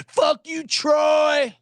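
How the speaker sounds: high, loud heckling yell; rowdy fan shouting at the broadcaster; jeering shout. Retold or imitated. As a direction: belt it at the announcer. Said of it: "It's just fun to say".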